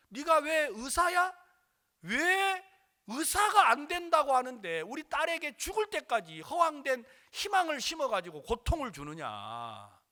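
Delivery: loud, high angry accusation; cursing father. Retold or imitated. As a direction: imitate a father berating him. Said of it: "욕을 하더라고요".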